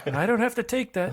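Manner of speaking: squeaky high-pitched protest; high mock voice. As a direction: whine it up high. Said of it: "Cadwallon says".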